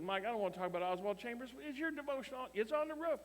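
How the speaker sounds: retold exasperation, climbing high in pitch; exasperated retort. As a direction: protest in a rising, high-pitched voice. Exasperated pastor argues over the devotional.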